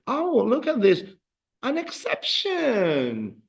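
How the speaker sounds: high, hammed-up amazement, slowing on the last word; mock surprise. Teacher unveiling an exception to students, playfully amazed. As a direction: marvel at it, stretching "exception" out high.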